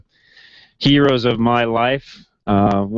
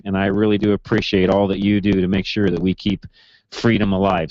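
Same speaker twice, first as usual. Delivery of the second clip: ordinary talk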